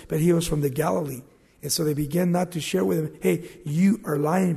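ordinary delivery

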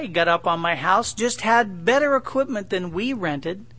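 normal talking voice

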